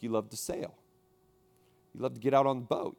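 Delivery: usual speaking voice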